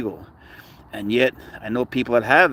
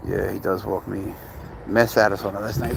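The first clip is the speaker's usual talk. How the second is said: normal talking voice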